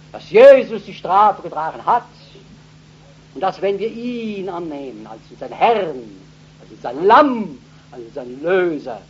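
loud, high proclamation; forceful preaching. Acted. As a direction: proclaim it to the whole hall. (kraftvolle Verkündigung)

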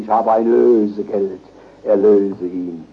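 low, slow and weighty; solemn proclamation. Real, not acted. Preacher names the ransom paid at Golgotha, solemn and slow. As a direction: lay each phrase down slowly and reverently.

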